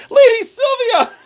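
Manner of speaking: squeals the name through laughter; laughing shout. Real, not acted. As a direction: burst out laughing as you call it. Laughing delight at viewer's name.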